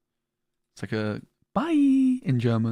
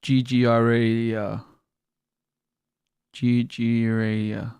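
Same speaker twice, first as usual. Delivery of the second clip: slow spelling; much slower than usual. Shown as a demonstration. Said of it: "Let me try again".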